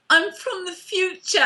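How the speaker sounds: teasing melodrama; mock dramatic line. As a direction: overact a cheesy heroine's reveal. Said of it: "all I could think of was".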